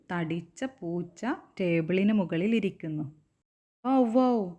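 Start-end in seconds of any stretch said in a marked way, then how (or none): 3.9-4.5 high, loud wow wow; a loud, high puppy's bark. Acted. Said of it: "the puppy barked at the cat".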